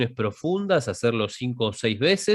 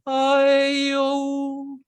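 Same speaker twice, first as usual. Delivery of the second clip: vowels sung much higher, slow; high register exercise; high vowel vocalise; 12 semitones higher, much slower than usual. Shown as a demonstration. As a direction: lift the tone and hold each vowel. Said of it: "Tono arriba, agudo".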